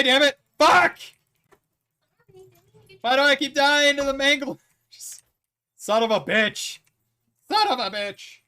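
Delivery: loud, high furious gamer rage; angry yelling. Real, not acted. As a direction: scream in frustration at dying. Dying repeatedly in game, raging.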